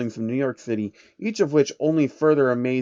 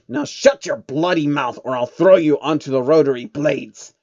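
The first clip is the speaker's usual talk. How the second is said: mildly menacing banker snapping at the pest; venomous threat; 5 dB louder than usual. Acted. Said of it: "venom filling his every word".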